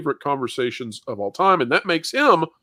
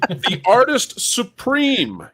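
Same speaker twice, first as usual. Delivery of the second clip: announcer voice; 9 semitones higher, much slower than usual. Acted. Host announces guest like showman.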